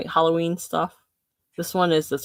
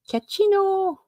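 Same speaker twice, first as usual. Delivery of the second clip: high sing-song. Pretend. Says product name in put-on voice.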